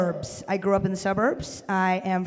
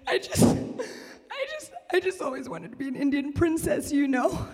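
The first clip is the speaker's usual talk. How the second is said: high, halting, dreamy tipsy confession; starry-eyed tipsy fan; 6 semitones higher than usual. Acted. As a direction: play her tipsy and dazzled, voice way up. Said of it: "starry-eyed, and she's, like, a couple drinks in".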